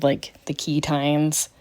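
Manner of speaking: ordinary talk, nothing marked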